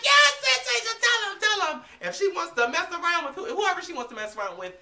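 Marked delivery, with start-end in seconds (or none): 0.0-1.8 high, loud squeal of cheering; excited cheering. Retold or imitated. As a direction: cheer her on like a fan going wild. Cheering [name] on at concert.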